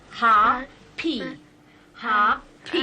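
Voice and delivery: "Ha, pee" split in two, loud and clear; loud broken-up "Ha, pee" for a child to copy; syllable by syllable. Shown as a demonstration. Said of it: "He says hoppy now for happy".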